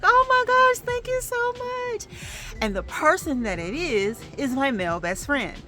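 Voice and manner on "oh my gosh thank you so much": very high squeal, slowing on the thanks; gushing gratitude. Retold or imitated. Thanking the friend who came to hug her, squealing. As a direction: re-enact being overcome with thanks, squealing. Re-enacting grateful reaction to friend's visit.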